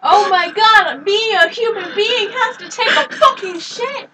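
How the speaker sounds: loud, slow, hammed-up shock; mock outrage. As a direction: overact amazed disbelief, shouting. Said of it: "did I make the stream more entertaining".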